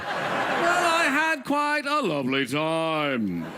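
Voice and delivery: mock British presenter, slow; lightly done British announcer voice. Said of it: "Let's talk like British T V announcers".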